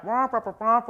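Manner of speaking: muffled trombone-like wah-wah; cartoon teacher's wordless honking; Charlie Brown teacher. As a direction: imitate meaningless droning adult speech. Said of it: "the teacher on Charlie Brown".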